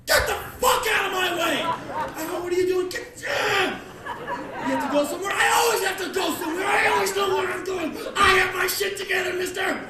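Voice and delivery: high-pitched, screaming tirade; livid shouting. Acted. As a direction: explode at the dawdler at full volume. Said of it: "Me, I am livid".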